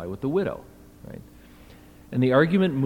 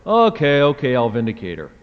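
giving in; 7 dB louder than usual. Acted. Judge finally relenting to the persistent widow, exasperated. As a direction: cave in with an exasperated okay. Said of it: "What he said was finally".

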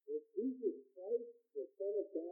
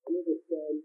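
booming preacher's opening, much louder; loud pulpit invocation; 7 semitones lower, 11 dB louder than usual. Acted. Preacher opening the service to the congregation, loud ringing invocation. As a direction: call the blessing out over the congregation.

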